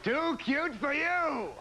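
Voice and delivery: high, very slow showman call, laughing; Ed McMahon announcing. Retold or imitated. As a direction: stretch it out like a game-show host. Imitating Ed McMahon intro.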